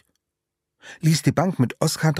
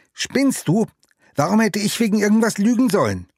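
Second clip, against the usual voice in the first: indignant outrage; 10 semitones higher, 4 dB louder than usual. Acted. Friends quarrel, Oskar indignant.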